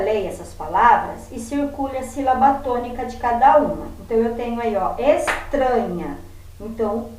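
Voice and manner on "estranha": "estranha" slowed, with weight on "tran"; stress on middle syllable. Shown as a demonstration. Teacher showing pupils the stressed syllable, "estranha" with strong "tran". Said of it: "é a sílaba mais forte".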